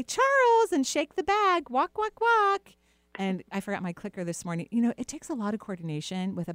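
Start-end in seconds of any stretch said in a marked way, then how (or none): 0.0-2.7 high sing-song call to the puppy; cheery high "walk, walk" to a puppy; calling the dog. Shown as a demonstration. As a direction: coax the dog out, much higher and louder. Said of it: "so if I say".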